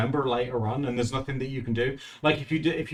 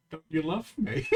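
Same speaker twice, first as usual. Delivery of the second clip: a touch of needy whine; pleading softly with a laugh; mock pleading; 6 semitones higher, 5 dB quieter than usual. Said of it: "she'll go".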